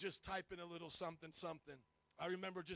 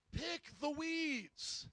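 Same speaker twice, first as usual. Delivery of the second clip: higher, very slow stunned repeat of the order; incredulous command; 8 semitones higher, 4 dB louder, much slower than usual. Retold or imitated. Pastor recalling an absurd order, high and slow disbelief. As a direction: drag it out in disbelief. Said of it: "here's the crazy thing".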